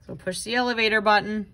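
plain reading voice

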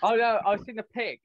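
ordinary talk

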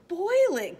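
high, loud burst of alarm; alarmed gasp. A narrator's reading. Horton hearing the speck will be boiled, aghast outcry. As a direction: gasp it out in horrified disbelief. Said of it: "gasped Horton".

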